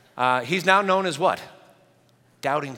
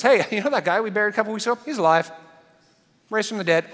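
fast, higher, laughing disbelief; excited friend; 6 semitones higher, 4 dB louder, faster than usual. Retold or imitated. A friend breathlessly announcing the buried guy is alive. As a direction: blurt it out like amazing gossip. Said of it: "another friend of yours says".

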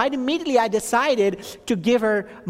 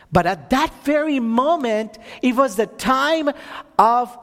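slowed, building preacher's cadence; earnest sermon build; preaching; 4 semitones higher than usual. Acted. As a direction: preach it, leaning on each phrase. Testimony rising into preaching.

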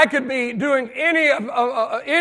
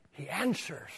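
hushed; 8 semitones lower, 18 dB quieter than usual. Acted. Dropping to hushed close.

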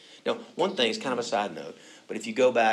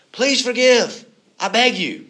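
pleading; 12 semitones higher, 11 dB louder, slower than usual. Acted. Brothers' pleading quote read.

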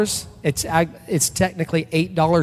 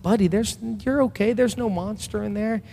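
put-on higher, gentle reassurance to a child; dad higher and soothing, telling his son there's no monster; reassuring dad; 7 semitones higher than usual. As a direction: soothe a frightened little boy.

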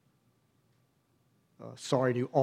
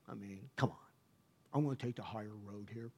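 hushed pleading; 5 semitones lower, 11 dB quieter than usual. Retold or imitated. Bargaining with God under breath.

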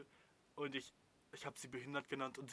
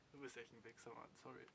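much softer, muttered insult; host insulting the viewer much more softly; quiet aside; 13 dB quieter than usual. Real, not acted. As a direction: drop it under your breath. Quiet insult after outburst.

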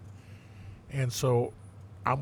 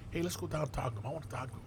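quiet aside to partner; 3 semitones higher, 10 dB quieter, much faster than usual. Retold or imitated. Telling his partner he wants the suspect, softly. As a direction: keep it down, just between partners.

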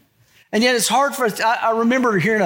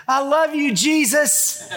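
put-on 1 child's voice; 8 semitones higher, slower than usual. Toddler calls out to loudspeaker voice.

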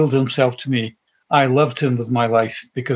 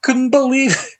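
emotional; 10 semitones higher than usual. Real, not acted. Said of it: "Sorry for being so emotional".

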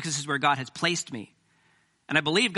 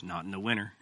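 lightly voiced Southern pastor, chuckling; Southern Baptist voice; 10 semitones lower, 8 dB quieter than usual. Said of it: "he goes".

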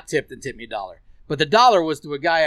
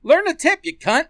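shouted insult; yelling; 12 semitones higher, 3 dB louder than usual. Acted. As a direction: yell it out in anger. Venting at the non-tipping rider.

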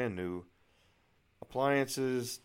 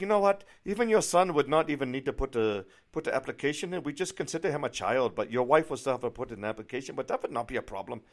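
imitated German accent, higher and emphatic, easing down; German realtor; 4 semitones higher, 5 dB louder than usual. German realtor explaining the application to a client, imitated accent. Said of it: "tell by the accent she's obviously from Germany".

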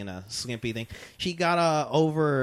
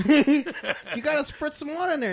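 laughing through the words; 13 semitones higher, 4 dB louder than usual. Real, not acted. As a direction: say it mid-laugh, high pitched. Laughing while explaining bidet.

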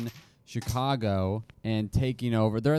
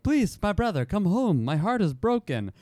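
mock Italian accent; 7 semitones higher than usual. Reads Al's plea in character.

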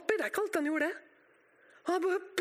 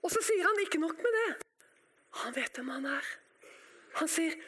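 ordinary talk, nothing marked

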